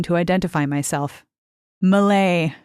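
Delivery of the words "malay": normal talking voice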